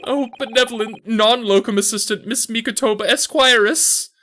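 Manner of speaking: overwrought client honouring his helper; gushing gratitude. Acted. Client thanking the non-locum assistant, gushing.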